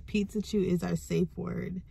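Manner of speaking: ordinary delivery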